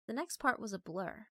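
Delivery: ordinary delivery, nothing marked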